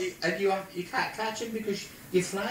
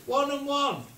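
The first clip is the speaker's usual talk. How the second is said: high-pitched called-out line; shouting across; 10 semitones higher, 4 dB louder than usual. Retold or imitated. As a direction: call it out to someone far away. Shouted joke exchange.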